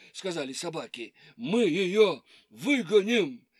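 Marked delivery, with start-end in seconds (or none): 1.4-3.5 dog voice. Acted. Dogs boast.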